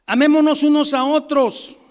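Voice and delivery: loud, high, ringing call to the congregation; booming proclamation. Real, not acted. Preacher calling the church to love each other, loud and ringing. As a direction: proclaim the verse from the pulpit.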